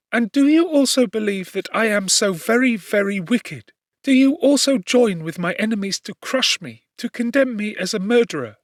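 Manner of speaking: understated sob-choked appeal; sobbing plea. A narrator's reading. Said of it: "Her voice was suffocated with sobs".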